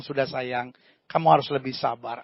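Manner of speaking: calm an angry husband tenderly; soothing wife. Acted. Wife soothes angry husband, soft and gentle. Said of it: "dia tegur dan dia bilang".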